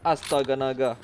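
ordinary talk, nothing marked

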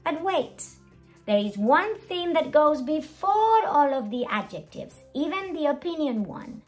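ordinary delivery

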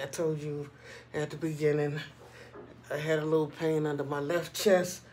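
normal talking voice

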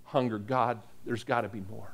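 hushed prayer. Retold or imitated. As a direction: breathe it as a desperate prayer.

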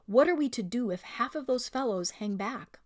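usual speaking voice

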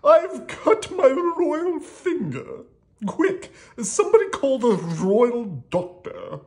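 high, loud, drawn-out royal complaint; hammed-up distress in a king voice; crying king. A narrator's reading. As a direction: wail for help as a hurt storybook king. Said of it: "cried the king".

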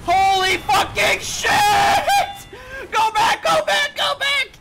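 high, loud retreat call; urgent shout. Real, not acted. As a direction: yell to the team to fall back.

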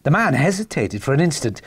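ordinary delivery, nothing marked

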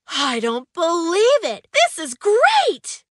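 thrilled surprise, shouting out at a discovery; surprised exclamation. Acted. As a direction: burst out in amazed delight. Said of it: "he exclaimed in surprise".

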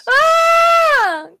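high, loud delighted cry; excited squeal. Real, not acted. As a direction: shriek with delight at something cute. Squealing at the cute dog.

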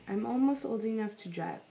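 ordinary delivery

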